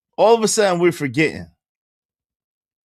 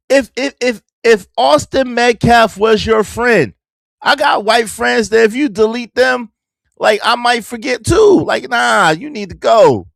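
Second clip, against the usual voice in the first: heated rant; 6 semitones higher, 4 dB louder than usual. Real, not acted.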